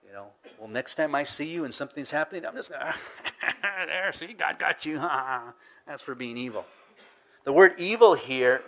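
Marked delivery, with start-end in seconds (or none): 0.6-6.6 lightly acted gloat, broken by a laugh; mock gloating. Wronged person seeing enemy suffer, mildly mock gloating. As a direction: gloat a little, as if vengeance has come.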